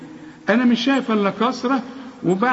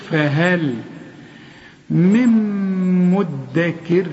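recited verse; much slower than usual. Shown as a demonstration. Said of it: "اتعلم من القرآن الحروف".

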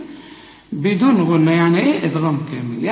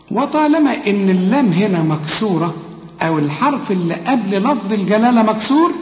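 ordinary talk, nothing marked

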